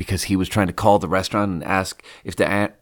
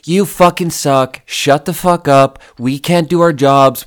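louder retold angry yell; cussing him out; 5 semitones higher, 5 dB louder than usual. Narrator cussing out the kitchen manager, loud retold angry yell. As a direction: snap back at the screaming boss. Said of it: "I was just like".

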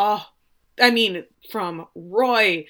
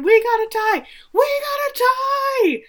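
high, loud celebratory yell; screaming crowd; 6 dB louder than usual. Retold or imitated. Fans celebrating the tying goal, high screaming. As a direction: imitate fans screaming, pitched way up. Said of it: "They're screaming".

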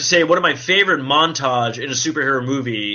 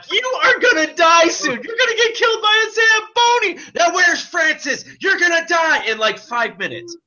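manic laughing Deadpool. Retold or imitated. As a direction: cackle threats from the Zamboni. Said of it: "laughing his ass off".

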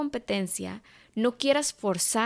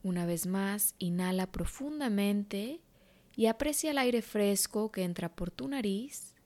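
guided meditation; 6 dB quieter than usual. Acted. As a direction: guide her gently into calm.

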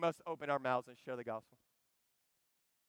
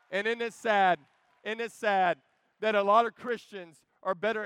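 louder, stressing sad twice; loud lament; 3 semitones higher, 10 dB louder than usual. Real, not acted. Preacher lamenting to the church, loud and pained. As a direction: call it out, grieving.

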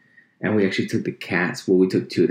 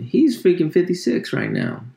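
higher and incredulous; amazed; 12 semitones higher, 3 dB louder than usual. Real, not acted. Amazed at Obama's age.

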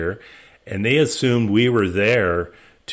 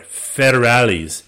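drawn out slowly, louder; slow menacing warning; 3 semitones higher, 5 dB louder, slower than usual. Retold or imitated. To the translator at the police sign, slow and loud. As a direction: let the threat sink in.

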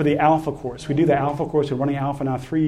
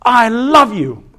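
tender dad; 6 semitones higher, 6 dB louder, slower than usual. Retold or imitated. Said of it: "and he goes".

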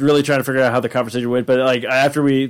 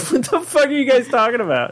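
laughing; 10 semitones higher than usual. Retold or imitated. Imitating Denny laughing, confused.

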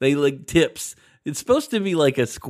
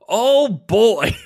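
root canal doctor surprised; 9 semitones higher, 9 dB louder than usual. Retold or imitated. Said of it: "He's like".

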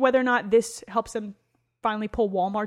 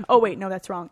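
ordinary talk

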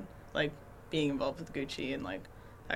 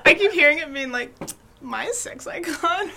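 laughing; 9 semitones higher, 9 dB louder than usual. Real, not acted. Laughing at misheard phrase.

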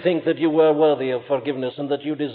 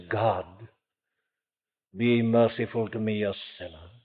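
much softer, lower, humbled prayer; the publican praying in the temple, softly and much lower; humble plea; 8 semitones lower, 10 dB quieter than usual. Retold or imitated. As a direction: murmur it as a sinner who dares not look up. Publican praying, can't lift face.

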